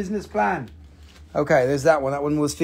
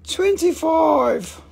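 twenty-five drawn out high; a playful high price call; sing-song price; 10 semitones higher, much slower than usual. Acted. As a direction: stretch the price up high and slow.

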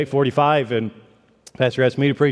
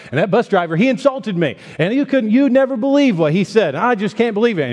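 high, rising, indignant retelling; upset ranting lady; 5 semitones higher than usual. Retold or imitated. Offended mother venting to the stranger, high and indignant. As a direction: pile on the outrage, higher and higher. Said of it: "She said".

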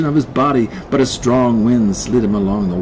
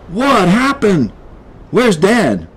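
high, urgent questions; alarmed kid; 9 semitones higher, 3 dB louder, slower than usual. Acted. Kid rushing up to find Dad, alarmed. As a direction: call it out in a slight panic.

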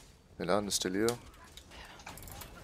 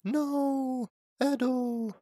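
high loud cry of dismay; anguished shout; 5 dB louder, much slower than usual. Real, not acted. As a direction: shout in sudden alarm. Teammate dies in fight.

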